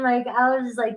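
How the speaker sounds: ordinary talk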